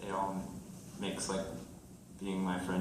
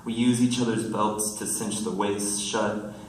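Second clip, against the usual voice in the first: loud, projected verse delivery; poem reading; 8 dB louder than usual. Acted. Poet reading a friends poem to the room, projected recital. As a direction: announce the title, then declaim the lines.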